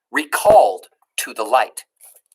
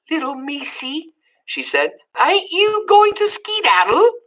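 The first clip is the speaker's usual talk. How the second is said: Meg's voice; 12 semitones higher than usual. Acted.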